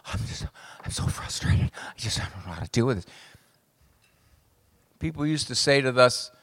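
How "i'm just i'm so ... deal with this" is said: breathy, near-whispered whimper; hammed-up frustrated whimper; whiny young parent. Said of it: "they're going".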